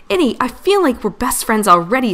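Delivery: high-pitched, eager gushing; excited squeal. A narrator's reading. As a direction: squeal it like a thrilled little friend. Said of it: "Scout squealed".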